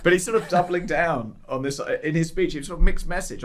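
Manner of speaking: mock Scottish accent. Carrying on the Humza impression for his co-host, mock Scottish. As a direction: keep the parody accent going, half-hearted. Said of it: "but in a Scottish accent".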